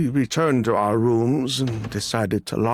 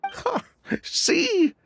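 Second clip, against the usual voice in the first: much higher, taunting "see?"; cocky higher told-you-so; smug vindication. Acted. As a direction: rub it in that you were right. Smugly claims vindication.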